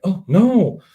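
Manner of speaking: high, loud mock alarm; dismayed cry. Doctor finding his safe gone, alarmed.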